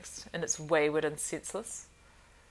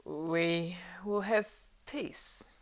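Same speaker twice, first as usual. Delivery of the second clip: words forced out thickly, near a whisper; thick, effortful; much slower than usual. A narrator's reading. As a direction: drag each word out with effort. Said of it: "thickly and with effort".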